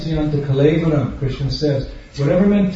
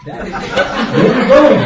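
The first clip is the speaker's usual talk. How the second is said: put-on much higher, louder kids' cry; kids shouting; 10 semitones higher, 6 dB louder than usual. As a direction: yell it like a kid in the back seat.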